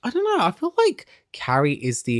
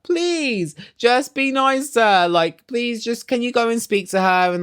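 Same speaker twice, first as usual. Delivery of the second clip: imitated tearful pleading; 8 semitones higher than usual. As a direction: plead in a put-on crying voice. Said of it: "crying to Carrie".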